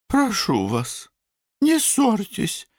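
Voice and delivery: very high, slow plaintive voice; wailing mother. A narrator's reading. Mother begging her sons not to quarrel, wailing. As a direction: plead in a high, drawn-out lament. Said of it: "запричитала госпожа Рупамера".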